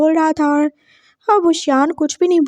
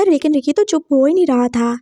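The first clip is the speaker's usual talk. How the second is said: ordinary delivery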